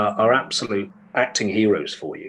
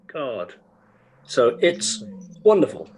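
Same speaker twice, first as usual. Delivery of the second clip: normal talking voice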